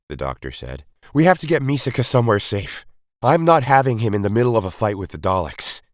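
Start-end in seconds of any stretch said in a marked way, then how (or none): 1.1-5.8 louder, insistent mother; fiercely protective plea; Zoella, protective. A narrator's reading. Zoella insists her son be kept safe, protective.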